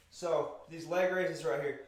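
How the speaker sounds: normal talking voice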